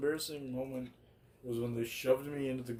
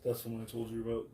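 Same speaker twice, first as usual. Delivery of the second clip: normal talking voice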